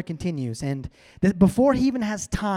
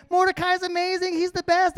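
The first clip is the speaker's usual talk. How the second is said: high-pitched imitation of an adoring crowd; mock cheering; 11 semitones higher than usual. Crowd cheers Mordecai through the city, mock high-pitched cheering. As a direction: cheer like a fan, pitched right up. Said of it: "everyone's cheering".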